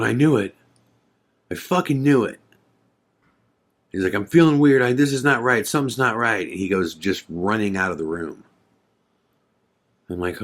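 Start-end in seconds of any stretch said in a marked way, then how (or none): 0.0-2.4 imitating a friend freaking out, higher pitch; mild mock panic as it dawns on him; retold freak-out. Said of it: "Joe starts freaking out". 4.1-6.5 imitating rising panic, high-pitched and urgent; mock panicked outburst, words tumbling out high; retold freak-out. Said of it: "Joe starts freaking out".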